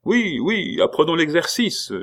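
high-pitched, eager cry; enthusiastic. A narrator's reading. An eager recruit begs to start drill, enthusiastic. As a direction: pipe up keenly, pitch leaping high. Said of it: "fit un des plus enthousiastes".

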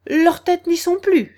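high, loud soldierly report; shouted back. A narrator's reading. Soldiers reporting to the Queen, shouting. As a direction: call back at full volume. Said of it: "lui crièrent les soldats".

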